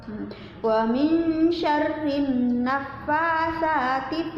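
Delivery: slow, drawn-out tajwid chant; Quran recitation. Shown as a demonstration. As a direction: stretch each syllable in recitation. Recites verses two to five.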